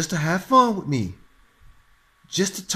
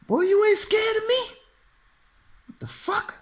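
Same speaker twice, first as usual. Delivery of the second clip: way higher, squeaky incredulous voice; hammed-up startled squeak; shocked disbelief. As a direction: yelp it in total disbelief. Speaker stunned she isn't scared.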